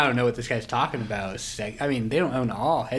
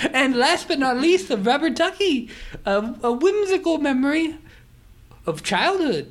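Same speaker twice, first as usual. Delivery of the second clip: higher, louder hammed-up posh English gent; posh English accent; 11 semitones higher, 5 dB louder than usual. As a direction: play the Monopoly tycoon in a plummy English accent. Monopoly man pitches new pieces.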